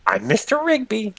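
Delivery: put-on high-pitched, squeaky drunk introduction; tipsy Mister Rigby. Game master greets a player as drunk Mister Rigby, high and silly.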